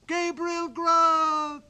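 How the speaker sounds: very high, loud, drawn-out ghostly chant; wild chorus of voices. Acted. Goblin chorus calling the sexton's name, high and drawn out. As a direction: wail his name like a goblin choir. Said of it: "A wild chorus of voices".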